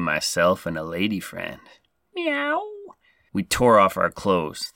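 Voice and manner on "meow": high, soft playful meow; cat meow. Acted. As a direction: purr out a flirty meow. Sexy cat noise after hot and heavy.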